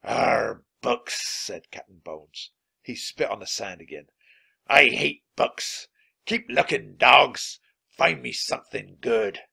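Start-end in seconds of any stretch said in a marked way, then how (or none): 0.0-1.3 growling pirate voice; scornful villain growl; disgusted pirate captain. A narrator's reading. 4.6-9.4 loud, growling pirate orders; forceful villain voice; angry pirate captain. A narrator's reading. Pirate captain snarls orders at crew.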